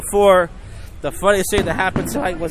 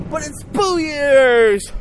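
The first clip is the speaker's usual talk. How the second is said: excited shout; 8 semitones higher, 3 dB louder, much slower than usual. Real, not acted. Hyping the New Year night.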